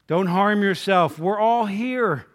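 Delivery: loud, slow, drawn-out call. Acted. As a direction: call out firmly to stop someone, stretching the words. Paul calls out to the jailer.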